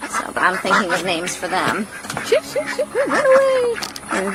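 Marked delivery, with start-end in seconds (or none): 2.3-3.7 high, sing-song shoo; high playful shooing. Real, not acted. Shooing the ducks.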